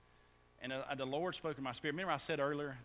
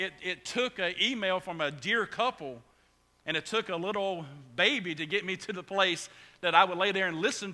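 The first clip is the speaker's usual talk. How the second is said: loud, raised, driving sermon voice; full-force preaching; 8 semitones higher, 16 dB louder than usual. Real, not acted. Pastor urges congregation on responding to failure, preaching at full force. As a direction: preach it out with force, pitched high.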